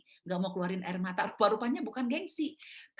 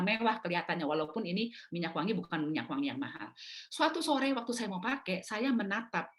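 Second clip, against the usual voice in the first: ordinary delivery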